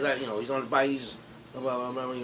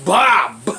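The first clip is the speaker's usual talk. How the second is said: high, loud "bah, bah"; imitated punch noises; 5 semitones higher, 12 dB louder than usual. Teller re-enacting the punches for laughs, loud punch noises. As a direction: belt out two blows as sound effects.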